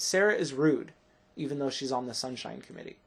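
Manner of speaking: misplaced gap after "rude", the rest tossed off fast; wrong comma pause. Shown as a demonstration. Teacher showing students a wrong rhythm, badly paused. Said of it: "The rhythm is wrong".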